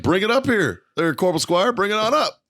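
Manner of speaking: imitated officer calling out; mock summons. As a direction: call him up to the front in a high voice. Retold officers summon the corporal.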